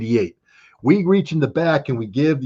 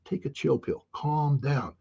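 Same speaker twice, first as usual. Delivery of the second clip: softer, lightly voiced kid brushing him off; a hint of a teen telling dad to calm down; imitating his kids; 3 semitones higher, 8 dB quieter, slower than usual. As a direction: kids hushing their podcasting dad, soft mock voice. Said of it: "They're like".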